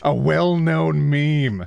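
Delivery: ordinary delivery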